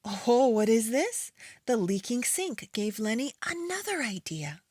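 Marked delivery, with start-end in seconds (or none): none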